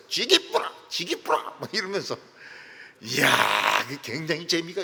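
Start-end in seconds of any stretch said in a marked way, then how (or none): 0.1-1.4 mock Gyeongsang dialect. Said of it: "경상도 사람은".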